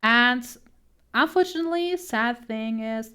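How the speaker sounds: ordinary talk, nothing marked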